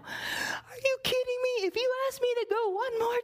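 a fed-up servant near the end of his rope; frustrated servant. Acted. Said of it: "probably getting frustrated".

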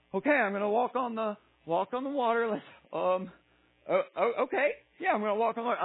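acted nervous reluctance; hesitant Peter. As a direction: stammer through it as if talking yourself into it. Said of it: "if he was kinda like that".

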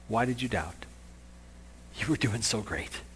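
very soft, low, gentle question; hushed, forgiving encouragement; tender. Acted. Jesus picking up Peter who doubted, softly and tenderly. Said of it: "That tender moment between Jesus and Peter".

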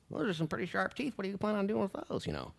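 small, high, put-on voice; mock-naive question in a high voice; naive victim act. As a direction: play innocent in a high, softened squeak. Acts naive person facing sharp teeth.

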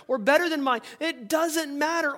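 ordinary talk, nothing marked

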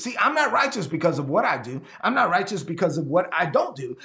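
ordinary delivery

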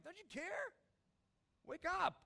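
high, loud shout; a believer crying out in spirit, high and loud; desperate shout. Acted. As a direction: yell at him to wake up. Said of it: "not shouting out loud".

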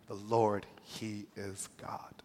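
soft, slow hushed worship; reverent whisper. Retold or imitated. People falling prostrate, whispering in awe. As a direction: breathe it out in awe. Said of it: "and cried".

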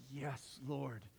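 soft devout murmur; hushed surrender. Retold or imitated. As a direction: breathe it out reverently. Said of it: "we're like".

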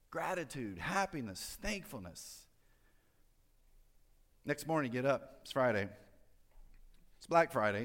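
ordinary delivery

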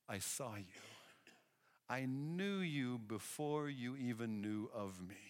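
soft, slow, intimate; hushed revelation. Retold or imitated. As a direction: let it land quietly. Jesus reveals he saw Nathanael.

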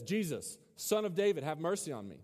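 ordinary talk

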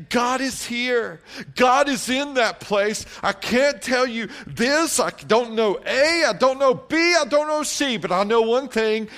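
high, loud, drawn-out preacher's delivery; rousing sermon climax, stretched key phrases; full-force preaching. Real, not acted. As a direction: proclaim it to the whole congregation.

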